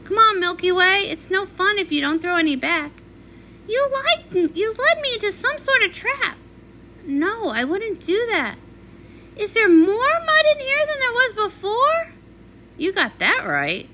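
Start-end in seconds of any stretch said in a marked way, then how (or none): 3.7-6.4 high, hurt accusation; betrayed and high-pitched; indignant accusation. Acted. Accusing them of a trap. 9.4-12.1 horrified at the mess; dismay at even more mud; appalled. Acted.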